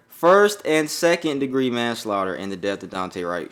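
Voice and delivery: usual speaking voice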